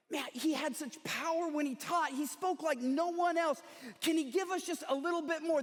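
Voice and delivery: eager expectancy. Acted. Onlooker at the cross, eager expectancy. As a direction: pitch it up like a hungry admirer. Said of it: "some of them are expectant".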